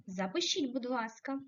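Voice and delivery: ordinary delivery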